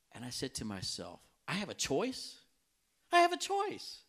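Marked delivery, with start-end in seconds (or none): 1.5-2.4 raised-pitch questioning disbelief; acted-out puzzled realisation; surprised question. Retold stunned question to himself. 3.1-4.0 very high, louder burst of amazement; hammed-up incredulous repeat; astonished realisation. Retold realisation, repeated more strongly.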